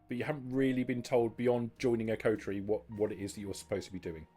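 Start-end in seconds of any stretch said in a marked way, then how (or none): none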